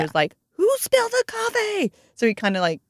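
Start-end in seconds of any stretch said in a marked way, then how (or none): 0.5-1.9 very high, hammed-up fake surprise; mock innocent. Chris pretending for the coffee prank, hammed-up high surprise. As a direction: play along, overdo the innocence.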